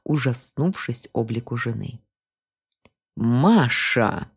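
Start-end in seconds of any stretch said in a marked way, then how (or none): 3.2-4.3 demonic growl. A narrator's reading. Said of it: "рыкнула на него Глафира".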